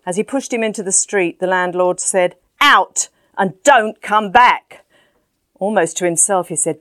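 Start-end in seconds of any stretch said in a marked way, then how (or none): 2.6-4.8 slow, firm and louder, each word laid down; stern eviction. Acted. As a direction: throw him out the door with it. Landlord throws man out.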